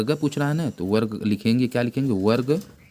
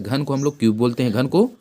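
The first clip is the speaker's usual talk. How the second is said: ordinary talk, nothing marked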